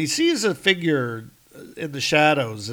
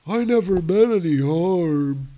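apologetic giant; 5 semitones higher, 6 dB louder than usual. Acted.

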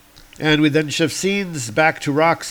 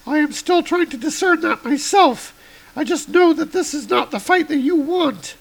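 much higher heroic woman; high woman's voice; 3 dB louder than usual. Acted. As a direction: lift the voice high for Girl Thor. Girl Thor voiced.

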